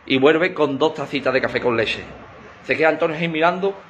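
ordinary talk

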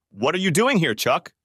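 snapped. A narrator's reading. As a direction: snap it at him. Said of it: "she snapped back".

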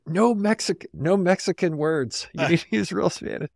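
correcting a student like a stern Spanish teacher; strict Spaniard tutor. Retold or imitated. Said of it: "my Spaniard Spanish tutor would be like".

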